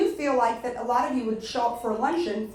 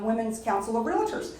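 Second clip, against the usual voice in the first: ordinary talk, nothing marked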